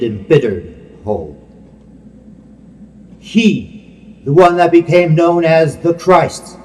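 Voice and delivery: ordinary talk, nothing marked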